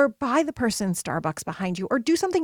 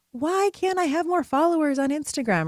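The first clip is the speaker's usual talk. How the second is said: higher-pitched mock whine of frustration; frustrated; 6 semitones higher than usual. Coach voicing her own frustration over Instagram followers, frustrated. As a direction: act out an exasperated complaint. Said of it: "I have been frustrated".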